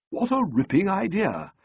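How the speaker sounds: lightly voiced impressed young man; a touch of delight at the idea. A narrator's reading. Young man reacting to Sally's plan, mildly impressed.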